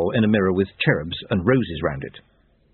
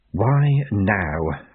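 hollow inner voice of Death; grim reaper voice; 2 semitones lower than usual. Acted. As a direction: think it aloud as Death. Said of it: "thought Death".

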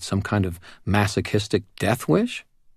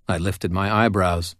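ordinary talk

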